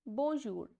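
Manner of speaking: "Bonjour" said as a teaching example; French bonjour. Shown as a demonstration. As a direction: model "Bonjour" cleanly. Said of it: "let's discuss this word".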